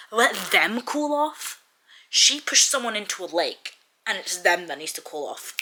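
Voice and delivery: outraged and incredulous, hitting words hard; incredulous outrage. Real, not acted. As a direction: throw it back in disbelief. Outraged repeat of line just read.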